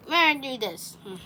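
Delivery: ordinary delivery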